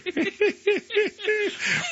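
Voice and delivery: high-pitched real laugh; real laughter. Real, not acted. Co-host cracking up at the Calgary impression, high real laughter. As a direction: burst into a long high laugh.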